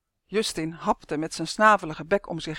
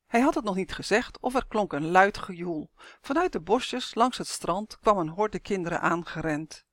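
ordinary delivery

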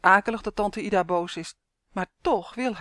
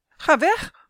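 high, loud shout at the dogs; boy calling out; 9 semitones higher, 6 dB louder than usual. A narrator's reading. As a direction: shove the dogs off with your voice. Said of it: "roept Henrik".

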